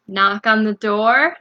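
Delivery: normal talking voice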